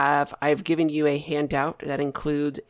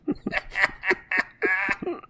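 higher-pitched, helpless giggling fit; laughing; 7 semitones higher, 6 dB quieter than usual. Real, not acted. Game master cracks up over storm sorcerers, laughing.

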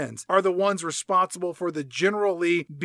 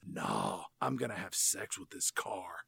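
low, very soft, near whisper; hushed mock-serious movie line; hushed confession; 9 semitones lower, 12 dB quieter than usual. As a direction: whisper it like an intimate secret. Hushed punchline of retold movie dialogue.

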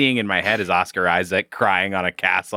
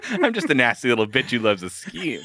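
laughing; 12 semitones higher than usual. Real, not acted. Laughing while playing along.

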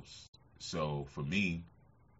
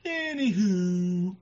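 high, drawn-out mock Anywho, much louder; caller's catchphrase; 12 semitones higher, 7 dB louder, much slower than usual. Mimicking an old caller's sign-off, high and drawn out. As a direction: stretch the Anywho way up high and slow. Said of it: "we used to always say".

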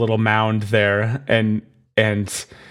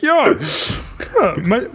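much higher, quick Kermit-like squeak; Jeff Goldblum as pirate; 11 semitones higher than usual. Retold or imitated. Goldblum as a pirate, high Kermit-like squeak. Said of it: "That's exactly how Jeff Goldblum sounds".

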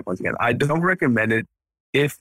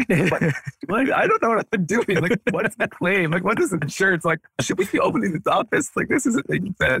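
higher, lost and overwhelmed questions; mock bewilderment; 9 semitones higher than usual. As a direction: play your clueless younger self, pitched up. Retelling own early-days confusion.

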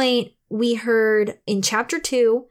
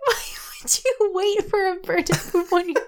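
laughing; 9 semitones higher, faster than usual. Real, not acted. Laughing at bird joke.

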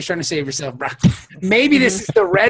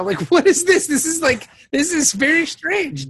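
pitched up in disbelief; incredulous; 10 semitones higher than usual. Real, not acted. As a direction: sound baffled and amused. Mock bewildered reaction.

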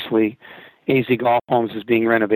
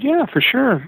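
much higher, eager boyish imitation; young kid voice; 14 semitones higher than usual. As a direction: play the kid offering help. Young kid voiced in retold story.

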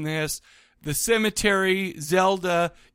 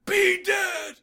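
high, hammed-up dad line; high mock shout of the dad's line; 11 semitones higher, slower than usual. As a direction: parody his wooden delivery, pitched way up. Quoting the dad's bad line.

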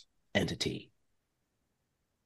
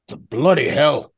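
half giant puzzled. Acted. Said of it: "says the half giant".

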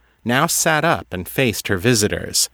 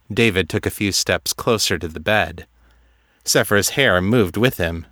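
usual speaking voice

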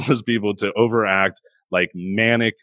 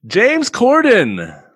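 exasperated dread; 11 semitones higher, 5 dB louder, much slower than usual. Real, not acted. Dreading the next actor.

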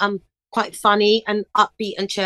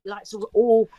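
usual speaking voice

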